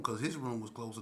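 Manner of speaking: ordinary talk, nothing marked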